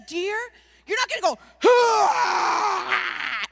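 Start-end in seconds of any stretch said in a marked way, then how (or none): 1.6-3.4 long, loud mock hunter's yell. Hunter charging at fawns the wrong way, yelling. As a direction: let out a big startling holler. Mimes wrong way to approach deer.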